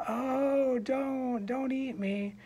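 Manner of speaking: high, whiny mock-scared plea; pleading little goat. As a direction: beg in a tiny put-on goat voice. Small goat pleads with the troll.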